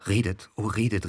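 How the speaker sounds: usual speaking voice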